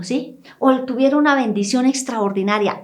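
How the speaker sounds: usual speaking voice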